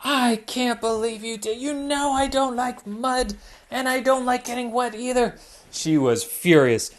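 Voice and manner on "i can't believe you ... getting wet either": upset Hannah. Acted. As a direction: scold her friend for the prank.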